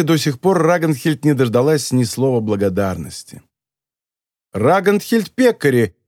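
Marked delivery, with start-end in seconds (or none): 4.5-5.9 exclaiming. A narrator's reading. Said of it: "воскликнул голос".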